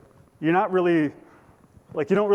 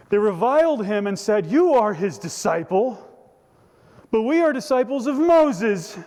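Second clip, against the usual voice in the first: haughty proclamation; 7 semitones higher, 4 dB louder than usual. Acted. Pharisees reviling the healed man as they claim Moses, haughty. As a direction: proclaim it loudly as superior insiders. Dramatic reading of Pharisees' proud retort.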